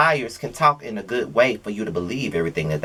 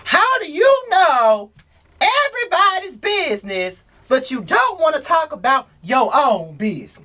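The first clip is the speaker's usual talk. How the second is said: lightly sassy high imitation; imitating Tasha K; 11 semitones higher than usual. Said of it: "Tasha K said in that video".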